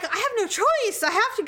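high-pitched, hammed-up protest of urgency; mock desperation. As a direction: plead your case with mock desperation. Acts out her desperate plea.

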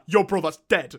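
hammed-up British accent, breaking into laughter; British slang accent. Podcaster parodies the steak complaint to co-host, hammed-up British accent. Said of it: "imagine that in a British accent, be like".

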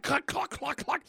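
high clucking sound effect; mouth-made slashing noises; rapid attack noises. Acted. Imitating fighting noises.

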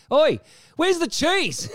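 high, slow drawn-out demand; a cheeky bellow across the room; mock yell. Friend barges into the photo shoot, slow high bellow. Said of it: "barges in and goes".

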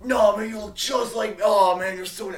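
loud, high shouting demon bros; distant shouting. Acted. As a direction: yell it from down the hallway. Demons arguing down the hallway.

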